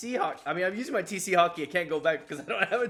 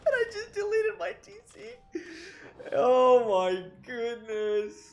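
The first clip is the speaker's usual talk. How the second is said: far higher, strained, laughing wail; laugh-crying; 6 semitones higher than usual. Real, not acted. Gamer admitting he deleted his town center, laugh-crying. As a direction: whimper it through laughter.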